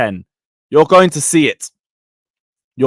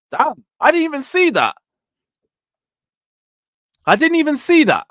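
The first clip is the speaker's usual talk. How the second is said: high-pitched disbelief; surprised; 11 semitones higher than usual. Real, not acted. Fan learns what he missed in the game, surprised. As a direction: react amazed at news you missed.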